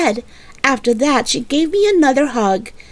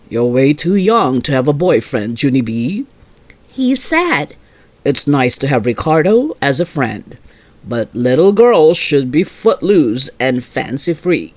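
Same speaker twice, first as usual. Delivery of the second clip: much lower, even fatherly voice; calm father; 9 semitones lower than usual. Acted. Father telling his daughter she is too young, much lower and calm. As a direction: say it like a patient dad.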